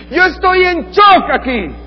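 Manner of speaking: very high, loud, slow disbelief; shocked outrage. Retold or imitated. Paul stunned that the churches strayed, loud slow outrage. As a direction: stretch out the shock at full voice. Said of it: "está diciendo Pablo".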